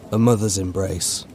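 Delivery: ordinary delivery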